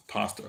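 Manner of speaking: "Pasta" with an open AH, PAHS-ta; broad "ah" in "Pasta"; own pronunciation of pasta. Shown as a demonstration. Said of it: "say it again".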